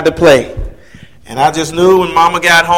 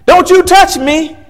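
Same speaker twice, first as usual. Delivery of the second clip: a higher, louder mock girl's yell; retold scream; 14 semitones higher, 5 dB louder, slower than usual. Girl in his path yells at the boy, a mock scream. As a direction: yell it at the boy who bumped her. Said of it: "she screamed out".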